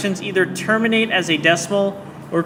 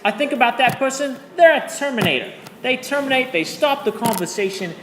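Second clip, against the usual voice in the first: higher-pitched, hammed-up British accent, slipping towards Australian; terrible British accent; 4 semitones higher than usual. Shown as a demonstration. Teacher fulfilling a promise to her class, terrible British accent. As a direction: put on a bad British accent. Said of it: "tell this story in a British accent".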